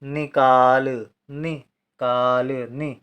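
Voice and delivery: निकाल drawn out very slowly, नि short and काल long; slow dictation. Shown as a demonstration. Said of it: "ना के साथ ई का उच्चारण कम समय के लिए". (निकाल को धीरे खींचकर बोलना)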